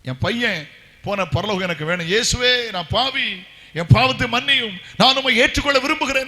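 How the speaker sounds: loud, high, wailing prayer; crying out prayer. Retold or imitated. Captain at boy's body crying loudly to Jesus. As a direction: cry out to Jesus in anguish. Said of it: "கதறி கதறி அழுதார்".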